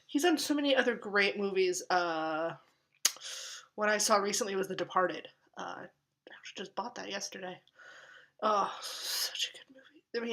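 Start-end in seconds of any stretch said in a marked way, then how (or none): 5.6-10.3 breathy whispered confession. Real, not acted. Admitting to viewers he bought it, whispered. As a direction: lean in and whisper it.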